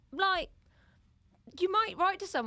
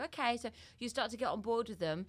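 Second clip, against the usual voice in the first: usual speaking voice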